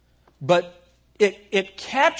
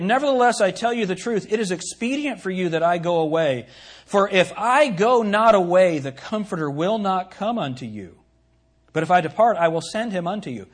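measured, raised preacher's reading of scripture; 4 dB louder than usual. Acted. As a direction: declaim the verse with weight. Reads scripture aloud.

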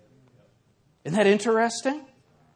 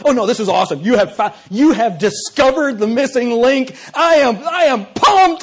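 mock excitement; 7 semitones higher, 9 dB louder than usual. Mocking professor with fake excitement.

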